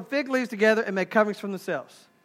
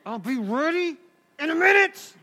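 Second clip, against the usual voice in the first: mock-sweet wife, pitch climbing at the end; high, put-on wifely assurance; imitated wife; 5 semitones higher than usual. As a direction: promise the husband in a put-on feminine voice. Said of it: "What'd you say to your husband?".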